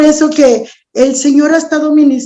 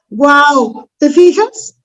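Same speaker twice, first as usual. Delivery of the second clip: ordinary delivery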